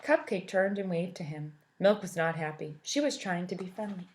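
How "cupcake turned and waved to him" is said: usual speaking voice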